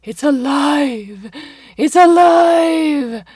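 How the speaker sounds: building mad-scientist shout, rising high and loud. A narrator's reading. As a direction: bellow it like Frankenstein. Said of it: "He felt the ridiculous need to shout".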